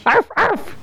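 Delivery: high, yappy mock barks; dog bark. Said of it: "Why are you making dog noises?".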